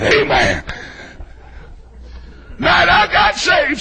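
usual speaking voice

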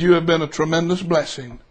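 low, soft, heartfelt thanks; tender sincerity. Retold or imitated. Customer thanks waitress for serving him and wife, tenderly sincere. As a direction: bring the voice right down, as if to her alone.

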